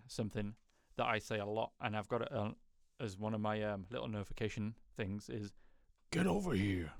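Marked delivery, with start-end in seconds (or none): 6.1-6.9 Mortal Kombat catchphrase imitation; menacing call from a fighting game; fighting game character. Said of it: "From Mortal Kombat".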